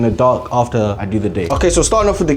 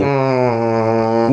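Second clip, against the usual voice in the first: microwave hum; 5 semitones lower than usual. Pretend. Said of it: "I'm gonna do a microwave impression".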